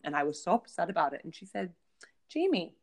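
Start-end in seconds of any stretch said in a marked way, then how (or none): 2.3-2.7 high, falling name call; mom's pointed address. Retold or imitated. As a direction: imitate a mother calling her daughter out. Mother's pointed address, acted.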